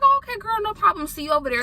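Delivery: light high-pitched imitation of a friendly traveler; friendly fellow passenger. Fellow traveler sees her off to Hobby, friendly high voice.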